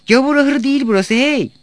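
high, loud indignant yell; angry shout. A narrator's reading. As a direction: yell a scolding across the room. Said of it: "Bağırdı Irazca". (öfkeyle, tiz sesle bağırma)

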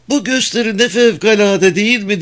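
high gushing woman's voice; Mildred. A narrator's reading. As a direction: gush like an excited wife. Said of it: "diye Mildred haykırdı".

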